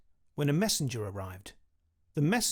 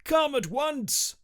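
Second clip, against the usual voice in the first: higher, louder, slow royal summons; shouting king; 11 semitones higher, 4 dB louder, slower than usual. A narrator's reading. King summons his knight, shouting slowly and high. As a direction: call him across the castle. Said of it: "he shouted".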